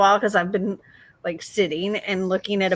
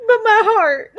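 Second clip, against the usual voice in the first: high, louder, laughing wail; mock heartbroken; 12 semitones higher, 6 dB louder than usual. Reader whines to partner about the sad book. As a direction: play up the heartbreak. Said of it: "I'm like".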